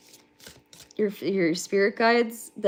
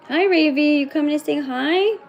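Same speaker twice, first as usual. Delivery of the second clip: high, slow sing-song greeting; cooing hello to the pets; 7 semitones higher, 4 dB louder than usual. Real, not acted. Greeting her pets as they come over, high baby talk.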